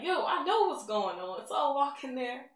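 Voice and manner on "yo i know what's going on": higher and louder, bursting with joy; excited realization. Retold or imitated. Daughter realizing her blind mother can see, excited. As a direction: shout it out as it dawns on you.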